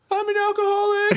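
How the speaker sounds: put-on very high, loud childish wail; hammed-up, high-pitched crying shout; mock tearful wail. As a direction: wail it like a kid bursting into tears. Kid's tearful outburst in a story.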